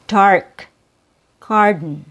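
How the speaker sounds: Dark and garden with the jaw dropped open; careful vowel demo; open-mouth vowel demo. Shown as a demonstration. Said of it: "so your mouth should be open".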